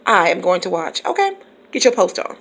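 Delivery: ordinary talk, nothing marked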